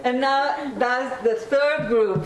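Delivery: plain reading voice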